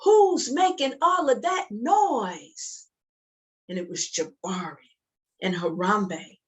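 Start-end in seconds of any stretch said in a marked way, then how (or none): 0.0-2.9 high, slow demand; mother calling out. Acted. Mother hearing noise upstairs, calling out high. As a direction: call up the stairs like a puzzled mum. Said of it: "came upstairs and said".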